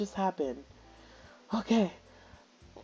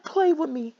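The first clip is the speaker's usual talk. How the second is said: imitating her; 8 semitones higher, 3 dB louder than usual. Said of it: "She said".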